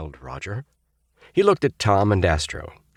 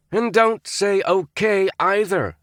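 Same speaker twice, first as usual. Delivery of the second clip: slow, stern upperclassman; stern chime-in; 14 semitones higher, slower than usual. A narrator's reading. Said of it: "Roger chimed in".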